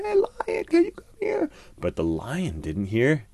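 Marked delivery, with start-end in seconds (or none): 0.0-1.5 calling from afar. A narrator's reading. People call the lion from far off, high and faint. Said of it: "people calling out".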